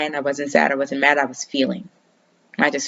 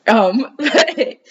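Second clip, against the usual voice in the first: laughing; 7 semitones higher than usual. Real, not acted. Laughing after own joke.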